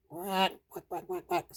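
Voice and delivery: soft quick quacks; duck quacking. A narrator's reading. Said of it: "said the ducks".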